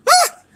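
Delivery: very high, loud reenacted shriek; girlish scream. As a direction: shriek in fright like a girl. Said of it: "screamed like a fucking girl".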